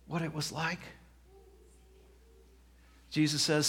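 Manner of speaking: ordinary talk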